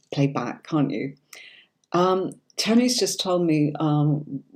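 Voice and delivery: normal talking voice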